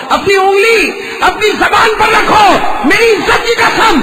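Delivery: high, loud, slow divine command; God commands Umar, slow and loud. Acted. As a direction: pronounce it slowly like a divine decree. Voice of God in story.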